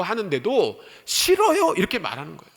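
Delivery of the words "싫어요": high, loud mock refusal; high-pitched balky protest. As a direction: imitate a defiant no, pitched way up. Acts out Peter refusing God.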